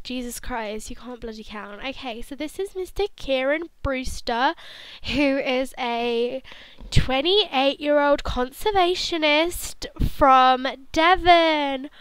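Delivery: usual speaking voice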